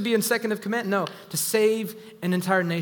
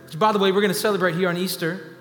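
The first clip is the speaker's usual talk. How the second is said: higher and louder, rising fervor; building preaching; 6 semitones higher, 4 dB louder than usual. Acted. Preacher driving the congregation toward the climax, rising and loud. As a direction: preach it up, climbing with every line.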